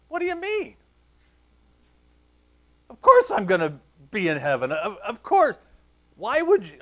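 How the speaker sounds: put-on high, loud incredulous kid; indignant. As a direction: protest as if the question were insulting. Kid's indignant thoughts acted out.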